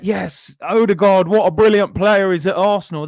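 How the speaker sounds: acting out fans getting carried away; excited fans. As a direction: cheer the new player like thrilled supporters. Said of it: "we're getting all excited".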